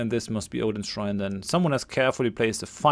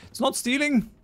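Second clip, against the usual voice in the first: pitched way up, mock innocent; high jokey denial. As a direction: squeak it out, playing innocent. Jokey denial while looting.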